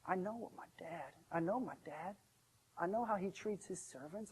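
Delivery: soft, low musing; thinking to himself. Acted. Hungry son thinks about going back to dad, quietly regretful. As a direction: voice it as a hushed private thought. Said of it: "he thought to himself".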